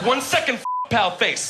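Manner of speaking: sneering, pitched high on second; a mock-nasty dig at pal face; how the insult sounded to him, mock sneer; hammed-up sneer. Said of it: "That's what I heard".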